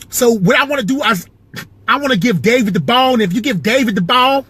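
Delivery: high, animated point-guard impression; Avery Johnson. Ex-point guard explaining giving David the ball, high Avery Johnson voice. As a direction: pitch it way up, very animated. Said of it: "I got Avery Johnson".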